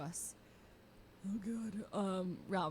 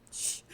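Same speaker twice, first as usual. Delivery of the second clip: imitated sharp "shh"; mock shushing. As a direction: shush someone as if in public. Retelling him shushing her.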